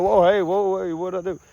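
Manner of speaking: high, alarmed imitation of a bystander; startled innocent. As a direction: act the confused victim backing off. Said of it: "And they're like".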